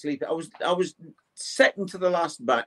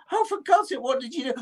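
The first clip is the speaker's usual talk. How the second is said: much higher, shocked disbelief; shocked exclamation; 11 semitones higher, faster than usual. Retold or imitated. Shocked and high. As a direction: friend hears a ghost story, shocked and exasperated. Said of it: "And I said".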